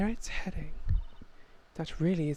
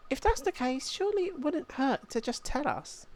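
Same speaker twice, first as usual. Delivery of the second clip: put-on much higher, boyish polite young voice; boy apprentice voice; 11 semitones higher than usual. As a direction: play the eager young apprentice, pitched well up. Luke voiced in game.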